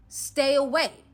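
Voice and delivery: loud, high "Stay away"; Jay scolding him, loud; stern warning. Retold or imitated. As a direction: bark the order. Jay scolding Bank Robber Bay.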